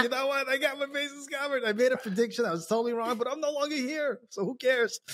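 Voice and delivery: high, soft smug executive; mock a careless boss; mocking CEO voice.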